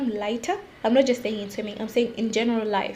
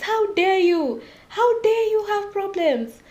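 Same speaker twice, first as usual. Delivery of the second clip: high, drawn-out mock outrage; mock indignation; 8 semitones higher, slower than usual. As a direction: play the scandalized judge, stretching it out. Mimics people judging her problems.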